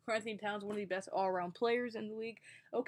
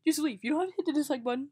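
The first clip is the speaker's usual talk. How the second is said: loud, fast, hammed-up plea; bad acting; 4 semitones higher, 8 dB louder, faster than usual. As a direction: overact a wounded plea, loudly. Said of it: "I'm a terrible actor".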